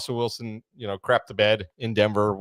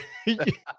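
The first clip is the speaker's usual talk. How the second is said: light laugh; 9 semitones higher, 6 dB quieter than usual. Real, not acted.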